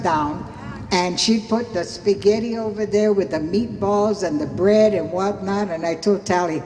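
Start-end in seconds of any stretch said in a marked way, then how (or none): none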